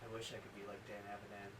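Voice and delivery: seductive deep voice. Acted. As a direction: keep the breathy seductive act. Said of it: "deep, sexy, seductive voice".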